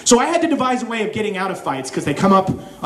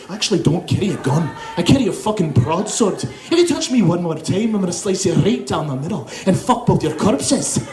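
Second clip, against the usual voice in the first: menacing put-on Scottish accent; mock-fierce Scottish brogue; crazy Scottish accent; 3 semitones lower than usual. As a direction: threaten wildly in a Scottish accent. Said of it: "if you use that accent".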